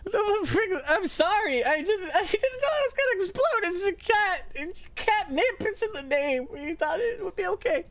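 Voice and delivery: squeaky sobbing apology; Gwen crying. Acted. Gwen begging Friggs's forgiveness, high tearful voice. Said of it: "she's just crying and she's like".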